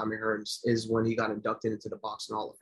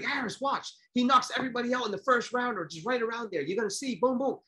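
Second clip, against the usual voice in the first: imitating dad hyping the fight, high-pitched; high, eager voice urging someone to watch; excited play-by-play promise, pitched way up; 10 semitones higher than usual. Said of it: "so I got excited too".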